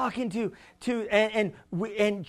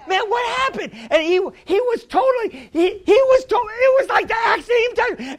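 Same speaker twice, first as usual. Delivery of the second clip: high, excited, stumbling retelling; retold amazement; 12 semitones higher, 5 dB louder than usual. Pastor recounting the young man's recovery, excited amazement. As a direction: blurt it out high in astonishment.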